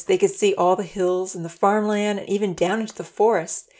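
ordinary delivery

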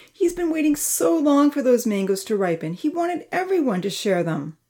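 high-pitched, sorrowful pleading; mournful. A narrator's reading. Amy laments Charlie's stolen mangoes, mournful. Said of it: "Amy mourned".

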